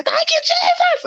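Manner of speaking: high, strained squeal, not at full volume; drawn-out, very high mock scream; held-back scream. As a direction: act out screaming thanks without really screaming. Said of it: "I don't wanna scream, though".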